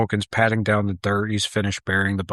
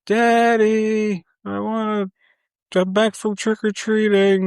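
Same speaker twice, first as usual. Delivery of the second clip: high, drawn-out mock child's holler; annoying kid yelling; 13 semitones higher, 5 dB louder than usual. Billy yelling from upstairs to his dad, annoying kid holler. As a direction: imitate a pestering boy calling for dad. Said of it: "starts yelling from the upstairs".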